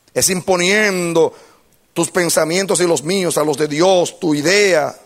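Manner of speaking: loud, high-pitched sermon delivery; full-force preaching. Real, not acted. Preacher tells the congregation the conclusion, preaching at full force. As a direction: preach it with force to a big crowd.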